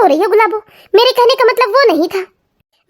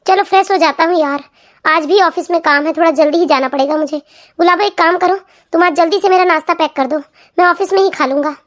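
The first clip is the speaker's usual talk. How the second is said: lower, matter-of-fact husband voice; busy husband; 4 semitones lower than usual. Acted. Husband asking wife to pack breakfast, casual lower voice. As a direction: casually hurry her along.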